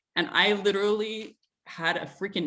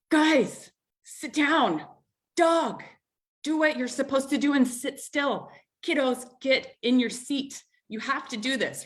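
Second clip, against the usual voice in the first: raised, higher voice, bossing the family into place; irritated yelling; 6 semitones higher than usual. Retold or imitated. Mom retelling how she barked at kids and dog to sit, raised irritated voice. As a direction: call out sharply, a retold mock yell getting impatient. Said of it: "just a little irritated".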